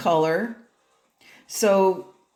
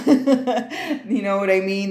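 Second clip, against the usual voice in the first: laughing through it, slowed down; 4 semitones higher, 4 dB louder, slower than usual. Real, not acted. Telling viewers about the cool aunt, laughing. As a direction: chuckle the words out slowly.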